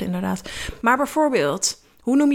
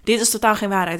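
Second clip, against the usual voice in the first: ordinary talk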